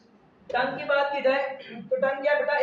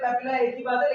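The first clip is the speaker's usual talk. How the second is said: normal talking voice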